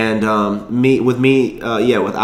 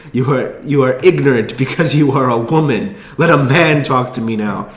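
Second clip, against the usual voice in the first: offended mock foreign accent, deliberate and emphatic; rough imitation of an odd foreign accent; Tommy Wiseau; 4 semitones higher than usual. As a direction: repeat the bad foreign-accent impression, indignant. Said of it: "I can't even do his fucking accent".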